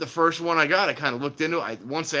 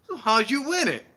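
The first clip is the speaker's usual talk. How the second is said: high, louder skeptical question; wife in commercial; 12 semitones higher, 5 dB louder than usual. Retold or imitated. Retelling wife questioning husband, high skeptical. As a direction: press him, doubtful. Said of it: "And she goes".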